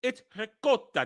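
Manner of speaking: "ricotta" with a K sound, no G; correct C sound. Shown as a demonstration. Correcting the G in ricotta, clean K sound. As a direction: say "ricotta" with an unvoiced C. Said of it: "The C is a little softer".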